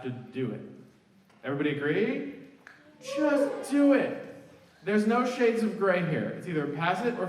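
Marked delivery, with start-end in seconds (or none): 3.0-4.2 high, slow, stretched-out insistence; emphatic plea. Real, not acted. To the students, pleading them to just do it. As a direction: lean hard on every word. Urging students to finish requirement.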